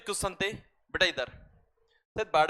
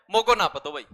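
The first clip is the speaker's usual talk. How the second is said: a louder mock eastern Rajasthani brush-off; imitating an eastern Rajasthani local, louder and laughing; rough Braj dialect; 8 dB louder than usual. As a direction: bark it like a blunt Braj villager, half-laughing. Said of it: "प्यार से भी बोलेगा ने".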